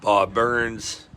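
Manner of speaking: ordinary talk, nothing marked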